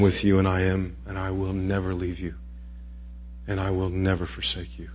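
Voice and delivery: soft, hushed and tender reassurance; gentle voice of Jesus. Acted. Jesus gently reassuring a struggling believer, hushed and tender. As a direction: ease a frightened believer into trust, softly.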